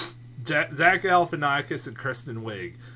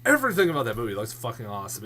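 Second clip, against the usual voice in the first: usual speaking voice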